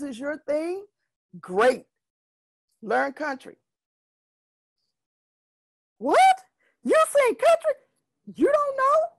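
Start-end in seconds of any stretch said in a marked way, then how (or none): 6.0-9.1 very high, loud squeaky falsetto outrage; shocked disbelief. Retold or imitated. Singer told to learn country, shrieking in high falsetto disbelief. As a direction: shriek it like a horrified jazz singer.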